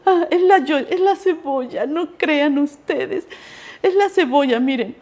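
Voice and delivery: teacher choking up, joking it's the onion; teary teacher. Retold or imitated. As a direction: pretend it's only the onion. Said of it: "Dijo la maestra".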